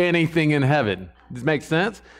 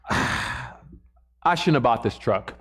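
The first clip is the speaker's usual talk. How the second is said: regretful prayer; 4 semitones lower, much slower than usual. Retold or imitated. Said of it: "So you go".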